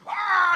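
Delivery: high, loud imitation of an enraged yell; reenacted angry "Ah!"; mad roar. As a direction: bellow like the furious driver. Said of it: "and he's mad".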